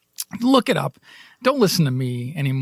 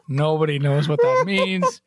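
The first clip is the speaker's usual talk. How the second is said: ordinary delivery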